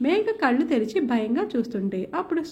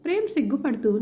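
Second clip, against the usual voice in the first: ordinary delivery, nothing marked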